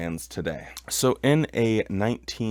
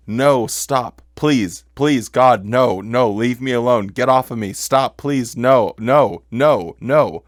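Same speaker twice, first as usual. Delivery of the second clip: retold pleading screams; 3 semitones higher, 7 dB louder than usual. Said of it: "Someone's screaming".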